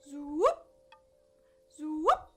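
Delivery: loud zoop swooping sharply upward; magic wand zoop. Acted. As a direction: make things vanish with a rising zoop. Father makes the giant vanish.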